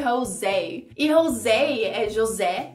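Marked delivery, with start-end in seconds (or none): 0.0-0.8 Spanish accent. Shown as a demonstration. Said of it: "falado com a pronúncia, eu acho que espanhola".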